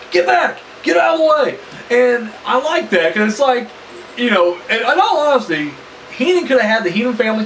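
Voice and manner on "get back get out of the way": raised, higher mock yell at the manager; retold yell. Referee yelling at Heenan to get back, a raised mock yell. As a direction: bark it like a referee shoving him off. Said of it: "like, yelling at Heenan".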